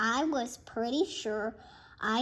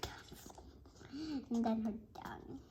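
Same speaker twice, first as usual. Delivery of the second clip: unvoiced whispered aside; 12 dB quieter, faster than usual. Real, not acted. The reader whispering a side comment, voiceless. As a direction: breathe it as a secret aside.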